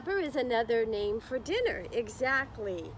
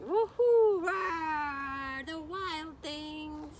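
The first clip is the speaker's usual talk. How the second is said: high, whooping monster party voices; playful wild things; 7 semitones higher, much slower than usual. Pretend. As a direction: whoop and holler like monsters having fun. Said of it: "they're all roaring".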